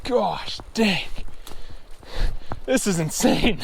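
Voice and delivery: 감탄하며 높게; awestruck. Real, not acted. Awestruck at the rushing river.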